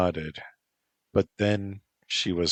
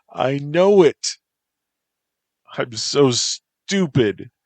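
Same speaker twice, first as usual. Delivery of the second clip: louder and higher, slow self-scolding; self-reproach; 8 semitones higher, 9 dB louder, slower than usual. Acted. Mike scolding himself to Lilia, loud and slow. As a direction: beat yourself up out loud.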